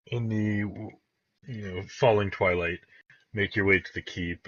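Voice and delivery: ordinary talk, nothing marked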